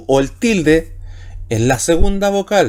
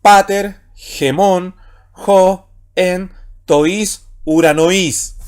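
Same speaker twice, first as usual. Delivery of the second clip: raised, word-by-word Greek reading; reading Greek aloud; 2 semitones higher, much slower than usual. Shown as a demonstration. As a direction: read each Greek word separately, firmly, with pauses. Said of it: "Sería esa primera lectura".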